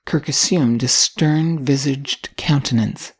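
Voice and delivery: usual speaking voice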